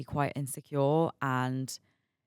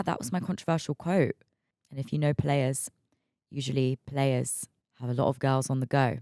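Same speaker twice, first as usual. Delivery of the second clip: normal talking voice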